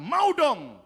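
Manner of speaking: high, loud eager yes; eager child. Retold or imitated. Child answering the mysterious voice, eager. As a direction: pipe up like a keen kid.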